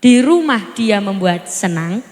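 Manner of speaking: low, measured preaching voice; sermon delivery. Acted. Preacher blesses the children present, low and measured. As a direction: preach it calmly and deliberately.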